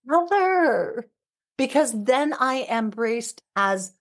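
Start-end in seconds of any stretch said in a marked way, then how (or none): 0.1-1.1 high, sighing mother of relief; acted relief pitched well up; relieved exclamation. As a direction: lift it high as if finally embraced. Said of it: "an environment that says".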